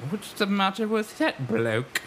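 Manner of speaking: mock British voice, slowed; lightly put-on Cockney fairgoer; English accent. Renaissance fair crowd jeering a weirdo, mock English accent. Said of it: "why they would talk like that".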